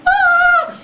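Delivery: very high, loud mock scream; excited scream. Teacher hearing first place announced, excited scream. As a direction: shriek as if just won.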